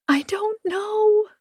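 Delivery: high, slow wailing cry. A narrator's reading. Anna wails to Louis on the phone, high slow wail. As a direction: let it break into a wail. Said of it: "I wail".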